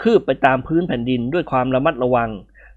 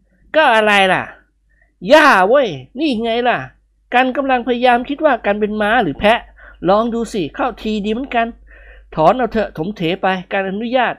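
higher, joking character voice; 7 semitones higher than usual. A narrator's reading. Grumbling friend chewing grass, higher character voice. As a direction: joke about being a horse or goat.

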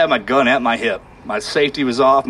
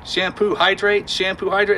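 slow, higher, careful words of a villager with little English; a lightly voiced Afghan villager, slow and higher; Gulab's broken English; 6 semitones higher than usual. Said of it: "That's what he's telling me".

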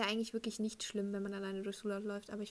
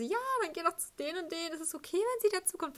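higher, louder mock teacher voice; imitated teacher; 8 semitones higher, 4 dB louder than usual. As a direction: play the teacher, pitched well up and pushy. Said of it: "dann der Lehrer so". (nachgeäffte Lehrerstimme, hoch und laut)

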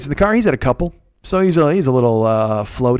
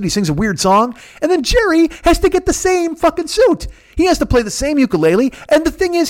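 pitched way up, ranting faster and faster; high frantic rant; 10 semitones higher than usual. Retold or imitated. Ranting to Dean about Tom and Jerry, voice pitched high and frantic. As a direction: rant it like a man possessed.

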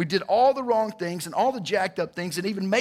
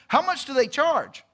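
high, keen, wide-swooping question; acted-out high-pitched curiosity; eager retold question; 8 semitones higher than usual. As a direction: ask it like a hopeful bargain hunter.

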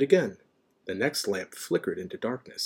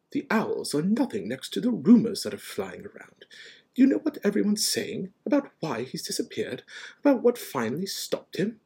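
insistent professor, pitched up; McGonagall; 9 semitones higher than usual. A narrator's reading. McGonagall probing the rumours, insistent. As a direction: press urgently with a rising question.

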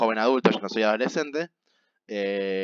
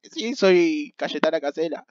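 put-on old lady voice, higher and slow; voz de anciana; 6 semitones higher, slower than usual. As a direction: put on a shaky grandma voice. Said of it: "¿Por qué esa voz?". (voz de anciana aguda y lenta)

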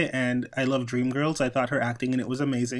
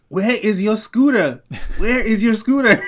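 loud, high mock fight taunt; Nene Leakes; 8 semitones higher, 8 dB louder than usual. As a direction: holler it like a reality-show fight, amused. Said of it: "Nene fighting with, uh, Kim Zolciak".

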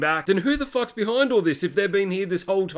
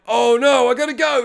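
high, loud alarmed outburst; mock panic; 7 semitones higher, 6 dB louder than usual. Hubie realising his mother is in danger, high mock panic. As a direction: blurt it high in retold panic. Learns killer is at mother's house.

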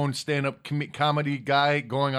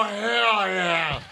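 wrestling promo; 4 semitones higher than usual. Acted. Reading chat, shouted catchphrase.

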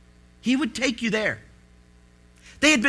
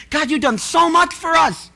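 raised, pleading cry to God, higher and louder; a desperate plea to a silent God; desperate prayer; 7 semitones higher, 6 dB louder than usual. Retold or imitated. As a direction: plead loudly with God, near breaking. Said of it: "We had felt like".

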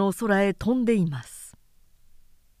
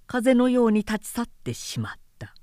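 ordinary delivery

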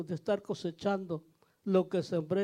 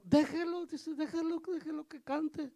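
much higher, lightly voiced missionary pleading; missionary's voice; 8 semitones higher than usual. Missionary tells the father to let the boy sing, high mock voice. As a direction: play the kindly missionary asking them to let him sing. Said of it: "Y decía un misionero".

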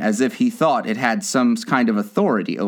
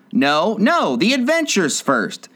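impatient; 7 semitones higher, slower than usual. A narrator's reading. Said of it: "in an impatient tone".

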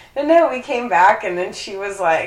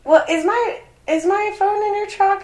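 imitating the neighbor lady; 12 semitones higher than usual. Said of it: "she's just like".

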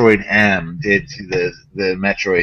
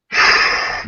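long, voiceless exhale; heavy sigh of disbelief; exasperated sigh. Real, not acted. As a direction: blow out a fed-up breath. Exasperated pause in the rant.

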